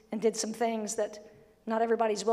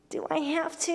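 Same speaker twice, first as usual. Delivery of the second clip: whiny question; 4 semitones higher than usual. Retold or imitated. Mocking reluctant churchgoer.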